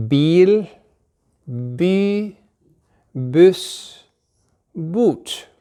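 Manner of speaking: Bil, by, buss, bot said slowly and clearly in Norwegian, each word on its own; Norwegian word demo. Shown as a demonstration. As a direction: model each Norwegian word cleanly for learners to copy. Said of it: "listen first".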